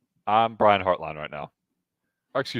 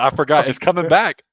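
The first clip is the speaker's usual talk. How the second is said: mock excitement; 7 semitones higher, 9 dB louder than usual. Mock sudden memory.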